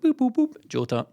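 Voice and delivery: high toy-like boops, then deadpan few words; David Turnbull. Retold or imitated. Teammate asked about Jota in an interview, terse comic impression. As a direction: mimic a footballer of few words, boops first. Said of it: "spot on impression there".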